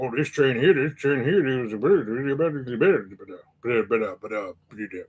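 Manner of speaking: a hint of mumbled backwoods Canadian; backwoods Canadian accent. Imitating the blacksmith's unclear speech, backwoods Canadian mumble. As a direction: mumble it like a Canadian Swamp People guy. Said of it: "he talks like the guy from Swamp People".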